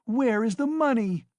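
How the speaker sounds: high, loud demand with a hard fall on money; wife demanding the lost money; cried out. A narrator's reading. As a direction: shout it in his face. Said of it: "she cried".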